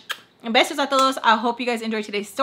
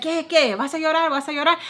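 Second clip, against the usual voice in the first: high-pitched imitation of a taunter; mimicked jeering, pitched well up; mocking taunt; 6 semitones higher than usual. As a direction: needle them in a put-on higher voice. Imitates her taunting about crying.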